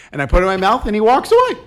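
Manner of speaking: normal talking voice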